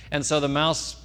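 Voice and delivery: ordinary delivery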